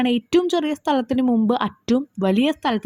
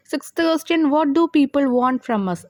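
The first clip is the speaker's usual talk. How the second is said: drawn-out reading of the exam question; slow reading aloud; 3 semitones higher, much slower than usual. Shown as a demonstration. Teacher reads the question paper to students, slowly. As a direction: read it out slowly so they can follow.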